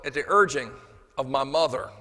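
ordinary talk

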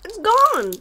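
high, loud overjoyed relief; ecstatic relief. Real, not acted. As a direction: shout it out, delighted. Yogurt relief.

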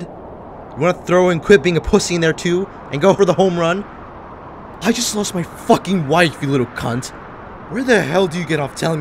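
rising to a loud, furious shout; furious outburst. Acted. Grieving widower to the man lecturing him, furious shout. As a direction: tear into him at full volume.

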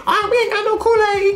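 high put-on voice of the drive-thru worker. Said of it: "And they say".

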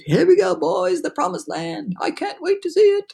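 high, thrilled storybook cries; mounting eager excitement; excited shouts. A narrator's reading. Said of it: "mounting excitement and shouts of".

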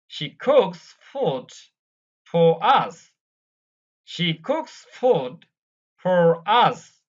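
slow, careful British reading for learners to repeat; slow dictation-style English reading; slow model reading. Shown as a demonstration. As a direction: read each line slowly and clearly so the class can echo it. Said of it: "আমরা slowly পড়ব".

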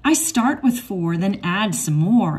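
usual speaking voice

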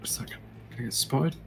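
hushed whisper while sneaking. Real, not acted. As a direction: whisper it so nobody hears. Sneaking past guards in game.

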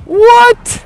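put-on getting the kids to shout what along with him; mock amazement. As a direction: play up the astonishment. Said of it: "Say it with me".

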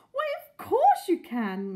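high, loud, drawn-out welcome; eager cry. A narrator's reading. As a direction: cry out in keen agreement. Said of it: "Missus Silver cried".

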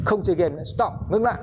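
high-pitched scolding order; mock telling-off; acted command. Acted order to stop gaming.

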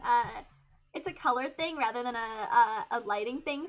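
usual speaking voice

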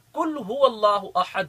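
"قل" said as "کل" with a light front k; wrong light kaaf. Shown as a demonstration. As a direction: mispronounce "قل" with a thin kaaf. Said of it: "ہم قل کی جگہ". ("قل" باریک کاف سے غلط ادائیگی)

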